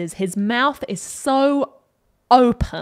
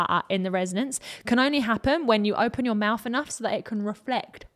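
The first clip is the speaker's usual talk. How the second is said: ordinary talk, nothing marked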